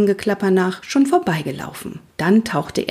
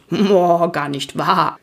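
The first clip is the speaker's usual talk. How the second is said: snorting protest; slower than usual. A narrator's reading. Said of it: "ließ einen lauten Protestschnauber los". (protestierend schnauben)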